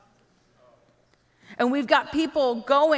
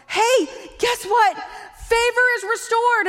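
loud, high-pitched mock evangelist; excited announcement; 9 semitones higher, 4 dB louder than usual. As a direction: call out thrilling news to strangers. Acting eager evangelist calling out.

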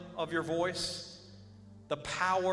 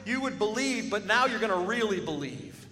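loud and high, driving the point home; fiery preacher at full volume; full-force preaching; 3 semitones higher, 5 dB louder than usual. Acted. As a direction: preach it out to the whole church.

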